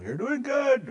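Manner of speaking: high, lightly voiced elder; elder's encouragement. Elder encouraging the teacher, lightly voiced. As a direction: mimic a kindly elder cheering on. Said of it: "sometimes they'll message Randall".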